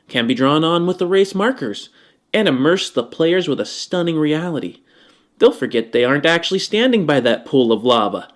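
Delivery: ordinary talk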